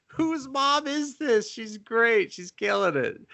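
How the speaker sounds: high, slow mock delight. His own reaction to the mom, high mock delight. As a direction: gush it in a squeaky voice. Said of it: "And I was like".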